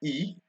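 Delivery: E as a high "ee"; French I sound. Shown as a demonstration. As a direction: say E like English "ee". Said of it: "it's pronounced E".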